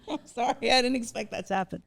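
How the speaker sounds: choked laugh, pitch jumping high; real tearful laugh breaking into an apology; laughing through tears. Real, not acted. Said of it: "I'm getting emotional".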